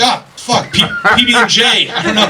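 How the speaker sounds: high, panicked stammer; flustered panic. Retold or imitated. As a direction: caught off guard ordering. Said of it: "you're like".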